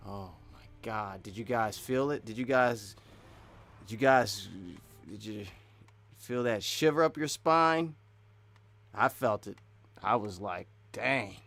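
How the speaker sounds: low, understated mock awe; sarcastic. Gamer asking viewers if they felt it, sarcastic. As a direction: play at being moved, pitched low. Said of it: "That's sarcasm".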